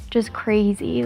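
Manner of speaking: ordinary delivery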